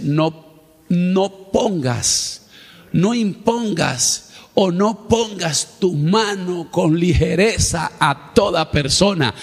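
slow, drawn-out solemn command; voice of God. Acted. Preacher voicing God to the church, slow solemn warning. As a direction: speak as God warning from on high. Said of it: "ahí es donde Dios dice".

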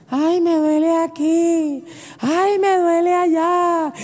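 high, slow, whiny mock wife; mock complaining wife. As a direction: ham up a wife moaning about aches.

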